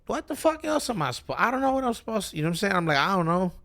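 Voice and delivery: high-pitched, loud, at a loss; re-enacted high-pitched "what now?" outburst; exasperated bewilderment. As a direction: throw it out in baffled exasperation. Acting out his own bewildered reaction.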